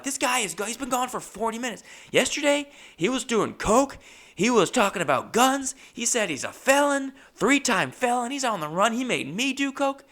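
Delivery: high-pitched, exasperated tattletale rant; agitated complaint. Retold or imitated. Customer reporting the tattoo guy to the boss, agitated and high. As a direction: reel off the offences in a high, worked-up voice.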